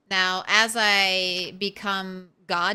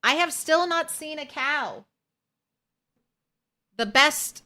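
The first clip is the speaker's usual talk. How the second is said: ordinary talk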